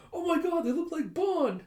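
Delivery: very high, loud squeal; high-pitched child scream; excited screaming. Retold or imitated. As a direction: squeal it like a kid charging in.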